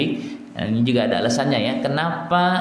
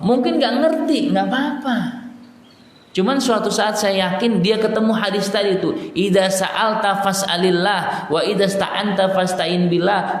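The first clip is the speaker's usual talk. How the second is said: raised, impassioned preacher voice; fervent preaching; 7 semitones higher than usual. Acted. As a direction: preach it up high with fervour. Preacher rising into hadith.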